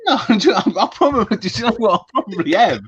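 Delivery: words broken by real laughter; sheepish laughing confession; laughing admission. Real, not acted. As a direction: own up through giggles. Caught eating on air, laughing.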